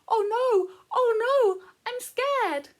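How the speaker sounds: high, loud mock-frightened cry; scared feeling voice. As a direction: squeal it out like a frightened little feeling. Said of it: "I think that one might be saying".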